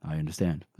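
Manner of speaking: low, quiet, unruffled mock reply; badass sergeant. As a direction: drop the voice for a tough soldier. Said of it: "Pike is badass".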